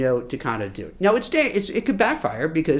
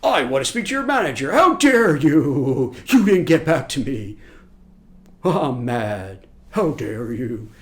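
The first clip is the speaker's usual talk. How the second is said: louder, outraged entitled rant, stretched slow on how dare you; mock Karen rant; 2 semitones lower, 4 dB louder, slower than usual. Recruiter mocking applicant who rants at a manager, loud outrage. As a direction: ham up a furious customer. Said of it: "You don't want to be".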